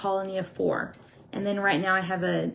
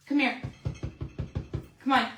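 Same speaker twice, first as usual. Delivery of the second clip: ordinary delivery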